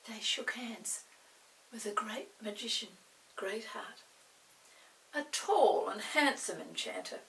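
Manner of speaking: usual speaking voice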